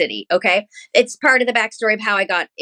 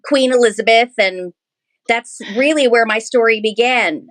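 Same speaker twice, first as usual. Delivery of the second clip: normal talking voice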